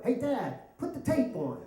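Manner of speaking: high-pitched imitation of eager kids; kids calling Dad. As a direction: mimic children calling to their father.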